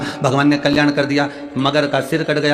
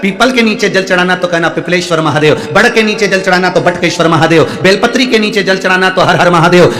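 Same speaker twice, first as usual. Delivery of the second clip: loud, raised sermon voice calling out to the crowd; fervent preaching; 5 semitones higher, 5 dB louder than usual. Acted. Guru urging the devotees to offer water anywhere, fervent loud preaching. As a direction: preach it full force, rousing the devotees.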